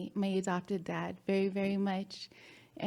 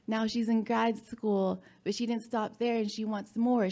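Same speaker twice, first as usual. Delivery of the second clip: ordinary delivery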